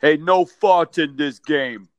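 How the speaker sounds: slow, loud booming basketball star; big booming voice. Retold or imitated. Teammate warning the mascot before the anthem, booming. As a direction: boom it out like a giant center. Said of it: "in his big booming voice".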